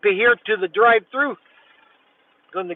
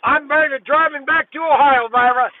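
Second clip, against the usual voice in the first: pitched up high, friendly and chatty; chirpy greeting; 8 semitones higher than usual. Real, not acted. Driver chatting up the drive-thru worker Myra, pitched up high. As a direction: beam at her through the window.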